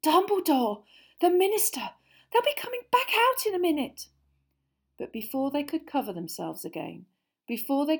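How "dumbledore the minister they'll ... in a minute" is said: high, fast, breathless warning; breathless urgency. Acted. Hermione warning Ron, breathless and hurried. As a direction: rush it out, winded. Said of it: "Hermione panted".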